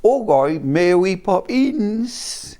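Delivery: dreadful mock Cockney, drawn out slowly. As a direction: do a terrible film Cockney accent.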